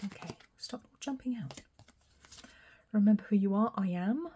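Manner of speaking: ordinary talk, nothing marked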